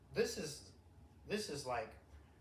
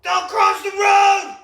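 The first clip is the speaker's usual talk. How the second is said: high, loud, slow barked order; hammed-up sheriff yelling; mock sheriff shout; 14 semitones higher, 20 dB louder, slower than usual. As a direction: yell it like an angry sheriff. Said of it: "that first sheriff I met".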